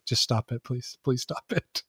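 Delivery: softly imitated plea; quiet, weary-wife quote; wife pleading. Wife begging him to drop the accent, softly imitated plea. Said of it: "she goes, she's like".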